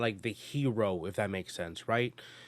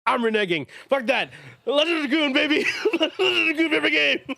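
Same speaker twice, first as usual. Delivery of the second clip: high, loud, laughing excitement; excited; 8 dB louder than usual. Real, not acted. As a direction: burst out giddy and loud. Excited reveal of favorite game.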